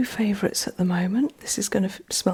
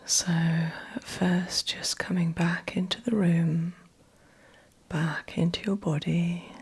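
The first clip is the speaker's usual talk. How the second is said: soft, breathy meditation voice with long pauses; guided meditation; 3 dB quieter than usual. Acted. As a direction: guide them gently back, phrase by phrase, leaving space. Guides client back into body.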